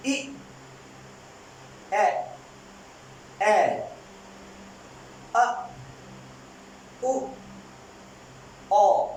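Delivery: short vowels for the class to echo; call and repeat. Shown as a demonstration. Teacher leads students to repeat vowels together. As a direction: model each vowel for repetition. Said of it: "जी मेरे साथ बोलिएगा".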